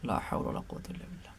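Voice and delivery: breathless whispered prayer under the breath; quick hushed invocation, almost inaudible; whispered aside. Real, not acted. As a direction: mutter it to yourself in a rapid whisper. Muttered aside during spelling drill.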